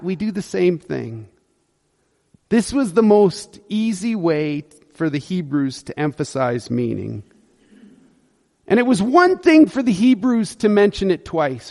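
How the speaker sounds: usual speaking voice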